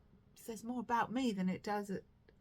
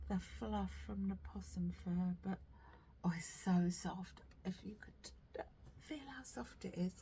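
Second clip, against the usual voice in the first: hushed whisper; 3 dB quieter than usual. Real, not acted. Knitter whispering to viewers about soft yarn. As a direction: whisper softly, marvelling at the yarn.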